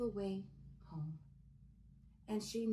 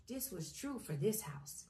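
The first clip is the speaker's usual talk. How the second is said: plain reading voice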